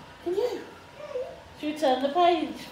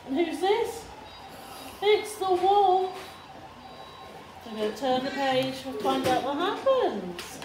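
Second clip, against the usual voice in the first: put-on reading to a toddler; 5 semitones higher, 6 dB quieter than usual. Adult reads picture book to toddler.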